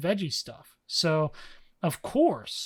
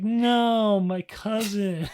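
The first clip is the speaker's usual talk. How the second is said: a loud high wail that slows on cousin; anguished cry; 5 semitones higher, 5 dB louder, much slower than usual. Retold or imitated. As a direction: cry out in grief over the cousin. Anguished cry over dead cousin.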